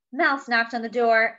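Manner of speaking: usual speaking voice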